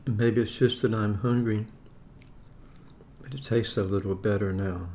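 ordinary talk, nothing marked

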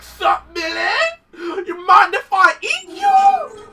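high, slow, loud silly monster voice; a giant frog greeting Billy before eating him. Acted. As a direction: ham up a hungry giant frog. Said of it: "some frogs came out of nowhere and be like".

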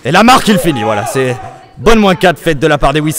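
excited call. Real, not acted. Caster builds on a team wipe.

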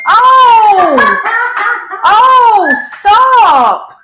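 loud high startled cry; startled shout. Real, not acted. Streamer yelping at her own face on TV, startled shout. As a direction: yelps in shock at the blaring TV.

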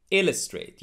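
ILLUSTRATE as "IL-uh-strate"; first-syllable stress on illustrate. Shown as a demonstration. Teacher modelling illustrate for learners, IL stressed. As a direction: punch IL in "illustrate", weak middle. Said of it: "put the stress on the first syllable".

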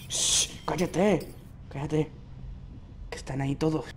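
soft, low secretive warning; hushing. Acted. Player warning companion of enemies nearby, hushed. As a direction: shush them and keep it down.

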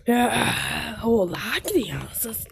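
low, breathy sinister clown whisper; creepy clown whisper. Acted. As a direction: taunt the kids in a whisper. Clown taunting trapped kids.

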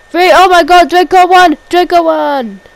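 loud, high victory call; triumphant play-by-play. Real, not acted. Commentator announcing that Draco won, triumphant shout. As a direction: shout the win over the pinfall.

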